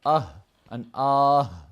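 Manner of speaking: Ah then ah, the first drawn out with a long vowel, the second cut short. Shown as a demonstration. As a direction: stretch the first ah, clip the second. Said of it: "One's with a mad and one's without a mad".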